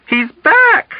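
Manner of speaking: very high, panicked cry; nurse's high shriek of alarm; hysterical scream. A narrator's reading. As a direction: scream it as you collapse in fright. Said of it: "Nurse Kramer screamed".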